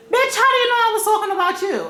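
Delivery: fast, high-pitched clapback; indignant comeback. Acted. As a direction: fire back at an imagined accuser, high and rapid. Acted retort to an imagined accuser.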